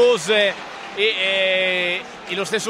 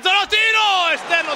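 excited commentary; 9 semitones higher than usual. Real, not acted. Shot on goal live.

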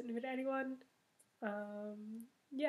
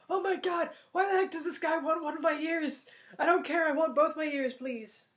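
higher, louder frightened pleading; terrified guest running; mock panic; panicked thought; 5 semitones higher, 7 dB louder than usual. Guest panics while fleeing.

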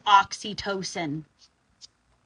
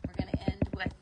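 normal talking voice

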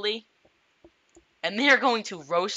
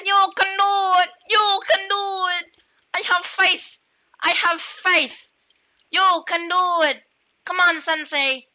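high-pitched cheerleader shouting; high cheering voice; 9 semitones higher than usual. Pretend. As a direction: cheer him on in a squeaky yell. Cheerleading his character.

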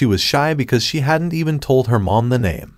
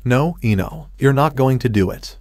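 ordinary delivery, nothing marked

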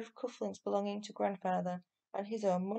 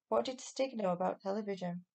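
usual speaking voice

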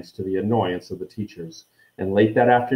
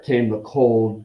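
ordinary delivery

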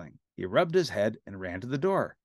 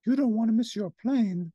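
higher coaxing lady voice; coaxing caseworker; Miss Finch; 11 semitones higher than usual. A narrator's reading. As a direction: urge him along, high and light. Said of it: "said Miss Finch".

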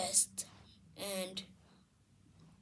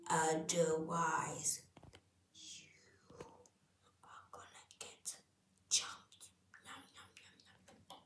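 whispering; 5 semitones lower, 9 dB quieter than usual. Acted. Menacing whisper threat.

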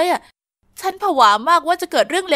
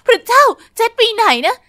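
panicked cry; 7 semitones higher, 6 dB louder than usual. Acted. Heroine panics, friend missing.

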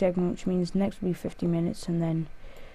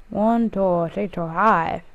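a bad Jamaican accent, counting; slower than usual. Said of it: "That was a bad Jamaican accent".

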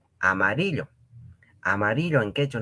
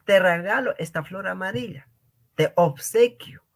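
playful romantic offer; mock romantic; 9 semitones higher than usual. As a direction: offer a flower, hammed up. Acting the gift offer.

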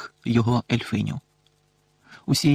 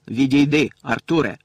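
louder, cold and sharp command; 4 dB louder than usual. A narrator's reading. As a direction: cut him off coldly. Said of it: "холодний, різкий голос". (холодний, різкий наказ)

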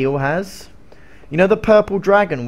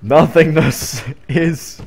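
slow, a bit louder portentous voice; hammed-up dark proclamation; mock ominous; 4 dB louder, much slower than usual. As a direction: intone it like a doom prophet. Ominous pronouncement, put-on voice.

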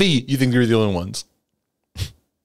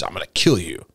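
Jezebel threat; 7 semitones lower than usual. Retold or imitated. Preacher voices Jezebel threatening Elijah: low menace. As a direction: growl it down low as the villain queen.

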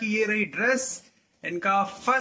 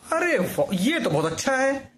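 delighted praise; 8 semitones higher than usual. Real, not acted. Parent praising child's outfit.

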